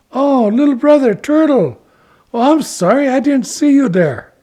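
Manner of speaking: high, loud, apologetic then coaxing; friendly, eager Ichiko. Acted. Ichiko apologizes to Turtle then recruits him, eagerly friendly. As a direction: coax little brother in a high voice.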